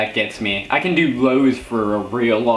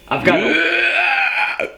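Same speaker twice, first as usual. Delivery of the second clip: high shout; much slower than usual. Real, not acted. Sudden yelled high outburst.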